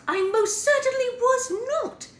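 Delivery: high, slow, outraged prince; shocked high-pitched indignation; indignant protest. Acted. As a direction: squeak out the denial in shock. Prince protesting his innocence.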